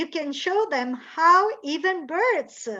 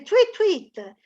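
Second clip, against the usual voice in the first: light tweets; 6 semitones higher, 4 dB louder than usual. Shown as a demonstration.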